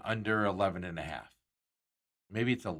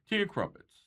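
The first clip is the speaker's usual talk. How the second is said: a lightly put-on, higher British accent; bad British accent; 10 semitones higher than usual. Joking about the Open, a bad British accent. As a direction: toss it off in a hammy British accent. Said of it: "my bad British accent".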